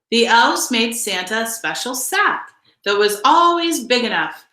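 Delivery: usual speaking voice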